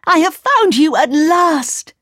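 high, slow, overjoyed exclamation; joyful cry. A narrator's reading. Girl has found Kay at last, crying out. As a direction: cry out at finding a lost friend. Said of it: "cried the voice".

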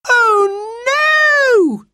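high, loud wail of dismay. A narrator's reading. Jane remembers the dreaded wish, wailing. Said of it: "she wailed".

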